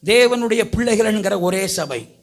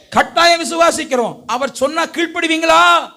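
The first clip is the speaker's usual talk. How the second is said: raised, insistent sermon voice; insistent preaching; 5 semitones higher, 5 dB louder than usual. Real, not acted. Preacher urging listeners to be baptised, insistent preaching. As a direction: press the point on the congregation.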